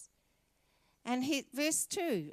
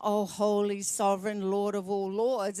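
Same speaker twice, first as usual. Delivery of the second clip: pompous churchy address, a little louder; mock formal prayer; 2 semitones lower, 5 dB louder than usual. Contrasting a stiff formal prayer to God, mock solemn. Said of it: "There's not, not".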